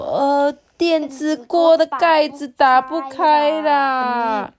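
slow, strained call for help; flustered mum stretching her words; anxious mother. A narrator's reading. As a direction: drag it out, high at first, in a fluster. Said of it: "就着急的说".

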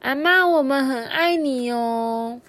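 put-on child's loud call, long held ending; loud loving call. A narrator's reading. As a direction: call out so grandma can hear. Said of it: "大声地说".

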